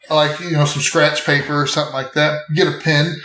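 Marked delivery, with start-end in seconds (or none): none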